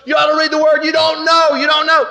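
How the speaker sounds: high, loud insistent yell; retold yelling. Preacher yelling at the huge supervisor, insistent. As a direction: shout it back at him, hammering home. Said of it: "yelling at each other".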